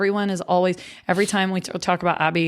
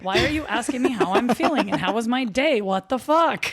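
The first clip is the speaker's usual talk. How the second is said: high, disbelieving squeaky tone; mock suspicious girlfriend; 6 semitones higher than usual. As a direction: act baffled that he's being nice. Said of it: "I'm weird and toxic sometimes because I'm like".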